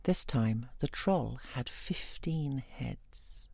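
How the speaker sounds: ordinary delivery, nothing marked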